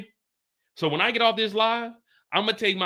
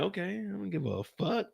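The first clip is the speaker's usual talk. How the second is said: a much softer, shrugging don't-care voice; soft, shrugging indifference; mock indifference; 10 dB quieter than usual. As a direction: toss it off like you couldn't care less. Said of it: "Lou's looking like".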